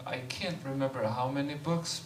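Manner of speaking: usual speaking voice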